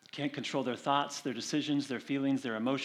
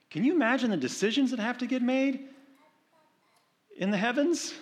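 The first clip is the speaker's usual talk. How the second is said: mock awe; 10 semitones higher, 4 dB louder than usual. Imagining heaven's decisions.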